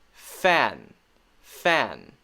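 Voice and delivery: "fan" drawn out slowly; short A demo. Shown as a demonstration. Teacher giving an example word, slow careful "fan". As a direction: stretch "fan" with its short vowel, falling. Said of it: "as in".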